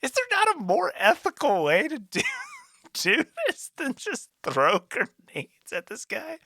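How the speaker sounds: laughing through the question; incredulous, giggling at the absurdity; laughing disbelief. Retold or imitated. Host recalling his reaction to grenades, laughing in disbelief. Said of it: "I was like".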